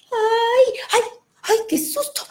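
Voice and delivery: high, startled yelps of fright; boy startled by the moving ink blot, crying out in fright; frightened cries. Acted. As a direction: jump back in fear.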